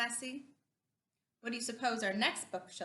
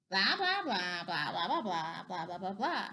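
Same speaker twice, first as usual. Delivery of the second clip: singsong blah-blah jabber, swooping up and down; mock grown-up babble with bouncing pitch; chattering grown-ups; 7 semitones lower than usual. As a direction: imitate tedious adult talk in nonsense syllables.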